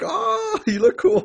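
high, gushing mock awe; imitated admiration. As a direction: play an impressed onlooker, voice shooting up. Voicing crew admiring Luffy's afro.

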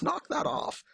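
a squeaky little king snapping at him; high squeaky scolding. Retold or imitated.

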